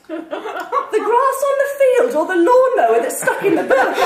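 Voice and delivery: high, loud comic disbelief; incredulous question. A narrator's reading. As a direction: build to a high, loud punchline. Aghast friend's comic punchline question.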